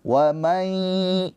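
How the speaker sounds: Wa may with the n merged into m and a long nasal hum; nasal ghunnah. Shown as a demonstration. Teacher demonstrates nasal merging to students. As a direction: hum may through the nose. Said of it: "kalau kita pencet hidungnya ada bunyi".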